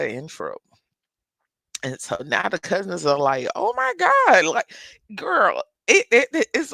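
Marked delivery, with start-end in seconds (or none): 3.5-6.7 high, loud gasped oh my God; shocked cousin reaction. Retold or imitated.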